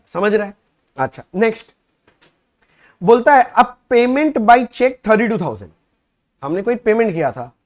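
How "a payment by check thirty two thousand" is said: slow, loud, word-by-word reading of the problem; reading the question aloud. Shown as a demonstration. Teacher reading the problem to students, slow and loud. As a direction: dictate each phrase slowly for the class.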